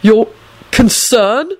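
broken, incredulous question rising high; spluttering. A narrator's reading. Neville stares at Dragomir in disbelief, spluttering. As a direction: splutter it with a halt after the first word. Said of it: "Neville spluttered out".

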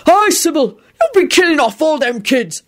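high-pitched rustic heckle; drunken shout. A narrator's reading. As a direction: heckle her like a swaying drunk. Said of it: "Hagrid shouted, swaying".

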